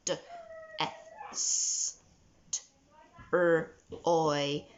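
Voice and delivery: each sound of destroy said slowly apart; slowed sounding out. Shown as a demonstration. Teacher sounding out destroy slowly for pupils. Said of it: "slow down a little bit with this one".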